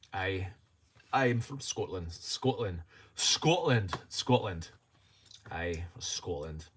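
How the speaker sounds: amateur Scottish accent, feeling out the vowels; practised Scottish accent. Shown as a demonstration. Streamer practising to say he is from Scotland, Scottish accent. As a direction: rehearse a Scottish accent word by word. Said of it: "my Scottish accent".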